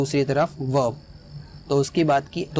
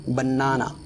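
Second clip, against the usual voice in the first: "Banana" with the weight on the middle "ना"; stress on middle syllable; 2 semitones lower, slower than usual. Shown as a demonstration. Teacher shows "banana", stress in the middle. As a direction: stretch the middle of "banana", ba-NAA-na. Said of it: "बीच में stress होगा".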